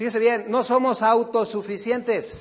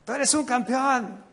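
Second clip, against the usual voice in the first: higher, pumped-up pastor chant; preacher mocking a motivational pastor, higher and pumped; hyped motivator, again; 6 semitones higher than usual. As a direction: push it like a hype man repeating himself. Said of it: "vuelve a decir".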